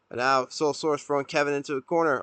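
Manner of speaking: ordinary delivery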